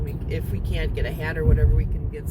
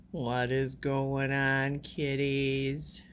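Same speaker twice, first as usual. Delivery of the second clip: slow, soft, lower pet talk; lightly cooing to cats; 3 semitones lower, 6 dB quieter, much slower than usual. Real, not acted. To the cats in their boxes, a soft slow coo. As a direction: greet the cats gently and slowly.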